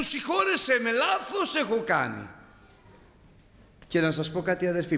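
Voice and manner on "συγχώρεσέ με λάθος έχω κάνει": high, imploring cry for forgiveness; a sinner's plea, voiced high; pleading prayer. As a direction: beg God to forgive you. Voicing a prayer of confession.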